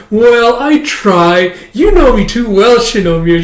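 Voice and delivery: high, loud, drawn-out preening; smug little girl. Acted. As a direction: kid basking in praise. Child smug at maid's praise.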